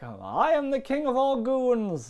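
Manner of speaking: slow, grand goon-king proclamation; dark lord. Retold or imitated. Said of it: "It's something like".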